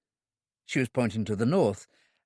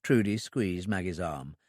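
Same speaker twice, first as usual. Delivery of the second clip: ordinary delivery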